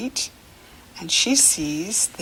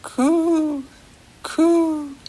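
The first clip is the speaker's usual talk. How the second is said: pigeon coo; 8 semitones higher than usual. Shown as a demonstration. Teacher voicing pigeons for children, high coo. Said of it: "And they are saying".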